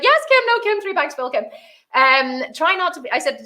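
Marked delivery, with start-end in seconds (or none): 0.0-1.4 high, sing-song yes-man imitation; mock dutiful staff. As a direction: parody obedient staff answering the boss. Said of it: "They're like".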